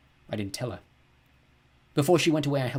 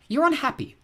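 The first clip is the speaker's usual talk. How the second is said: much higher, lightly voiced girl; girl's voice; 6 dB louder than usual. A narrator's reading. Mina notices the boy is unhappy, lightly voiced girl.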